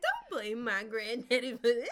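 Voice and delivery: a mock huffy put-down; indignant mock retort. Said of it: "And she was like".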